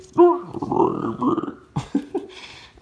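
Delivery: high, breathy villain cackle; loud, airy evil monster laugh. Acted. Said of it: "that's my Clayface voice".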